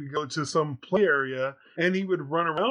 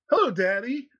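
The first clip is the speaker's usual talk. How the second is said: put-on chirpy toddler greeting; 8 semitones higher than usual. Son at four in morning.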